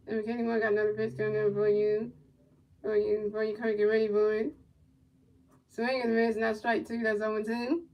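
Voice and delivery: low, quiet, steady game call; soft running commentary; play-by-play call. Acted. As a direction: murmur the pitches like a broadcaster.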